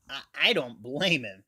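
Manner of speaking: ordinary talk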